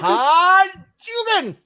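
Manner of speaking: high, loud, drawn-out battle yell; slow, ringing mock battle cry; game attack cry. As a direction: stretch it out like a fighting-game move. Calls out game move name playfully.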